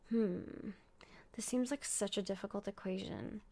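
a breathy mock whisper, pondering. Mock-puzzling to viewers, whispered.

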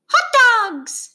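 put-on high, loud little-girl demand; demanding toddler. A narrator's reading. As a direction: shout it like a bossy tiny child. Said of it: "she demands".

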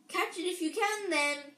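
high, loud taunting dare; shout. A narrator's reading. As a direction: shout a challenge as you throw. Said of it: "he shouted".

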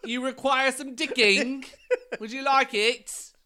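loud, high, hammed-up Cockney; mock Cockney accent. Co-host offering some digging, mock Cockney accent. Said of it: "My Cockney".

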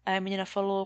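ordinary talk

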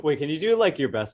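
normal talking voice

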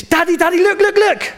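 put-on high, insistent little girl calling daddy; high childish pestering; imitating pestering daughter. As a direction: whine it like a kid wanting attention. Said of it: "it drives me bonkers".

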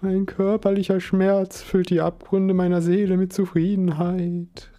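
lifted, solemn recital of a line; a touch of theatrical pathos; recited grandly. Acted. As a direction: declaim it like a proud author. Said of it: "rezitierte Chris".

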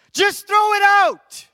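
drawn-out, high, exasperated shout; yelling at the TV. Retold or imitated. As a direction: holler it at the screen in frustration. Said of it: "I'm yelling at the T V".

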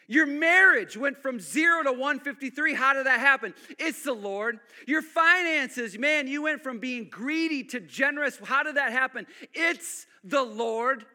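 loud, rising sermon climax; full-force preaching. Real, not acted. Pastor building to a loud climax before church. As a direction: preach it out to the back row.

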